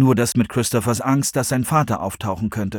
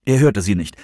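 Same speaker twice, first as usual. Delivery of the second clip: ordinary delivery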